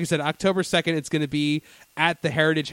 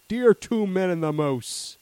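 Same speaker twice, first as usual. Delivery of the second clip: slow, drawn-out letter from a Canadian; mock a lecturing Canadian listener; 3 semitones higher, much slower than usual. A Canadian listener writes to the hosts, slow mock-stern voice. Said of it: "one of our Canadian listeners would be like".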